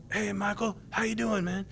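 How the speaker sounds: a casual greeting in a half-done gravelly impression; Mark Dawson. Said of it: "can't quite do Mark Dawson's gravelly voice justice".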